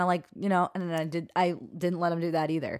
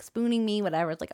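ordinary talk, nothing marked

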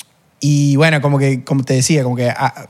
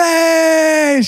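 high cheering yell; cheering shout; 14 semitones higher than usual. Retold or imitated. Argentines greeting each newcomer with a high cheering shout. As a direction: cheer a newcomer like a happy crowd. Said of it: "los argentinos empezaron a aplaudir".

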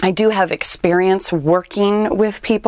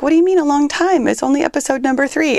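incredulous listener; 7 semitones higher, faster than usual. Retold or imitated. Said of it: "you're like".

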